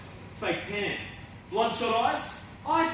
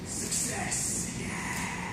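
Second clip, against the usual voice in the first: whispering; 8 dB quieter, much slower than usual. Acted. Whispered mantra with audience.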